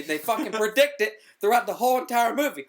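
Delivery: usual speaking voice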